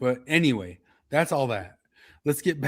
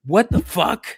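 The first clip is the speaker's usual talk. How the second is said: shocked outburst; 6 semitones higher, 4 dB louder than usual. Retold or imitated. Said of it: "Alex Jones has come out with an entire expose saying".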